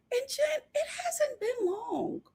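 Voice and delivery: high, soft, whimpering mock sob; fake-cry it like pleading with Jen. Whimpering at Jen about the new man, mock crying.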